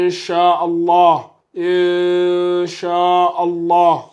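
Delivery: "Inch'Allah" very slowly, n hidden in a long nasal hum; drawn-out recited "Inch'Allah", light nasal hum for n; dissimulation with nasalization. Shown as a demonstration. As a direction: stretch the hidden n of "Inch'Allah" through the nose. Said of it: "C'est une dissimulation avec nasillement".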